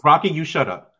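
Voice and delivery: playful mock scolding; mock angry. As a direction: snap at him in jest. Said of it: "because I'm mad at you now".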